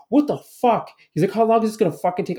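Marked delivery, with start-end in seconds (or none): 0.1-0.9 high, louder imitation of an angry driver; retold outraged yell, pitched way up; mock shout of exasperation. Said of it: "loudly fucking shouting". 1.3-2.4 fast, high mock outburst; retold angry demand, rushed and pitched up; shouting. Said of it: "loudly fucking shouting".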